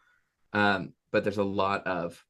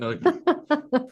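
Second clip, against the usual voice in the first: much higher, slow, squeaky laughing; 5 dB louder, much slower than usual. Real, not acted. Recalling a client jumping off the table, laughing. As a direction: break up with laughter mid-line.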